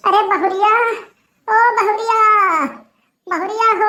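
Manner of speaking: calling out. Acted. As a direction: call the daughter-in-law from another room.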